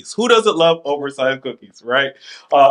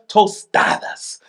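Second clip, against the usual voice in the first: "tostadas" drawn out in a Spanish accent; 4 semitones higher, slower than usual. Shown as a demonstration. Host showing off the menu item, Spanish accent on "tostadas". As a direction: relish the Spanish vowels in "tostadas". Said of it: "you like how I said tostadas".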